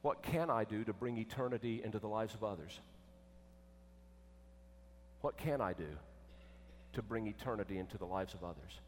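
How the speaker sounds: low, soft, measured asking; reverent question. Acted. Jesus inviting rich young ruler to ask anew, gentle reverence. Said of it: "he was inviting him to ask".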